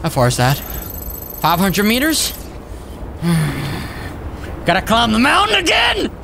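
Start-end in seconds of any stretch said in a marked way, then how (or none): 2.4-4.6 long soft exhale; weary sigh. Real, not acted. Sighs at the long distance. 4.7-6.1 high-pitched disbelieving shout; exclaimed dismay. Real, not acted. Dismay at climbing the mountain.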